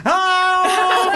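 high, loud, drawn-out mock greeting; hammed-up showbiz cry; very showbizzy. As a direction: sing it out like a star who's been recognised. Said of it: "she was sort of very show busy".

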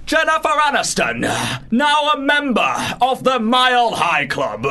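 high, loud, slow dramatic announcer; milk it like a talent show reveal; X Factor voice. Pretend. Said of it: "read that in the X Factor voice".

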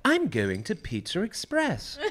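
high, slow put-on English accent; mocking English accent. Husband taking the piss out of his wife, mock English accent. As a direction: tease your wife with a hammed-up English accent. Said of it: "take the piss out of my wife by saying".

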